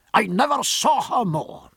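rueful Scottish burr; broad Scottish accent. A narrator's reading. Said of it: "invaded by his native Scotch".

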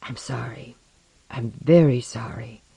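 whispered, contrite murmur; hushed, breathy and sincere; murmured apology. A narrator's reading. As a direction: apologize under your breath, tenderly. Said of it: "she murmured".

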